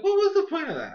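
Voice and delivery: high, quick laughing disbelief; incredulous. Retold or imitated. Reviewer reacting to a pointless villain scheme, incredulous. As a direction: repeat it in a squeaky baffled rush. Retelling own baffled reaction, repeated heightened.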